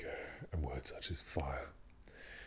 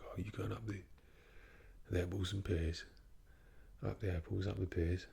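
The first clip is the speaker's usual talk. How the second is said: chirpy proper London slang in a London accent; 3 semitones higher than usual. Shown as a demonstration. Speaker showing off London slang, cheeky London accent. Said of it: "proper London accent".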